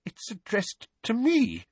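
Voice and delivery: high, frightened aunt; shaking voice. Acted. Aunt finding the letter is for her, in a shaking voice. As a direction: say it trembling with dread, pitched high. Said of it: "said Aunt Petunia in a shaking voice".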